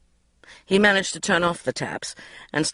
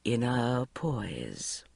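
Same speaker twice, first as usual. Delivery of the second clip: slow, much softer murmur; 5 semitones lower, 9 dB quieter, much slower than usual. A narrator's reading. Narrator to herself, murmuring slow and soft. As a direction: breathe the words out in forced calm. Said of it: "breathed in through flared nostrils and murmured".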